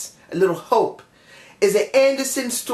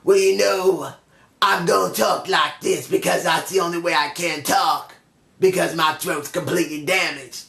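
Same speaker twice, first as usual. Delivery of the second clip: lower, worn-out wino voice in a monotone; pitched-down monotone of an old wino; Eric Young impression; 3 semitones lower than usual. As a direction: talk like your throat is completely damaged. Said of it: "he sounds like a old wino".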